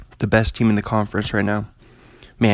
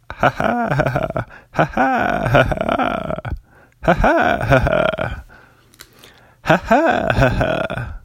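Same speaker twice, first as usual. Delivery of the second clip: slow, stiff, mechanical ha ha; robot laugh; 5 semitones higher, slower than usual. Retold or imitated. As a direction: laugh like a robot, evenly spaced. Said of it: "it sounds like a robot".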